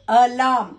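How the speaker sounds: alarm in two loud beats, "a-larm"; split into syllables. Shown as a demonstration. Teacher tests a monosyllable answer, sounding out alarm in two parts. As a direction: break alarm loudly after the first "a". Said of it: "Two syllables".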